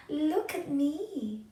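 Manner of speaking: high, slow look at me; young beetle showing off, high and slow; eager show-off. A narrator's reading. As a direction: call out proudly to be watched.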